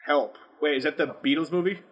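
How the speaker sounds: ordinary talk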